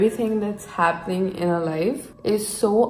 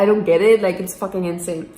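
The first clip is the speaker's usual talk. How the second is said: ordinary delivery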